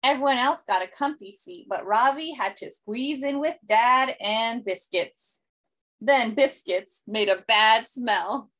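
usual speaking voice

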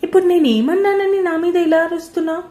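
high, loud, baffled asking; puzzled question. A narrator's reading. Mother asks her daughter why she yells, bewildered. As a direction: ask why she is being shouted at. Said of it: "ప్రశ్నార్థకంగా అడిగింది".

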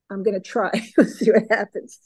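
ordinary talk

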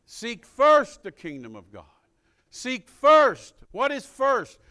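loud punch on "first"; forceful preaching. Real, not acted. Preacher urging people to seek first, loud and emphatic. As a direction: drive the command home to the congregation, voice rising high.

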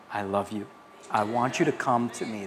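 low, hushed, loving appeal; tender. Retold or imitated. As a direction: speak God's love softly and gently. Voices God's tender call.

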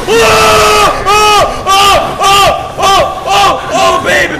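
high, loud frightened cries; panicked screaming. Real, not acted. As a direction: scream in terror. Chased by enemy.

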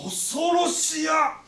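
slow, drawn-out fearful shout; mock terrified cry. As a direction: cry out in hammed-up fear. Mock terror in samurai role.

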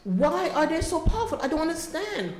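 high, anguished pleading; real upset, tearful. Real, not acted. Mother asking meeting why they're so powerful, tearfully upset. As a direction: ask it helplessly, voice rising with emotion. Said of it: "I'm sorry I keep doing this".

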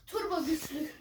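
ordinary delivery

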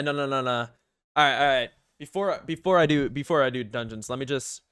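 usual speaking voice

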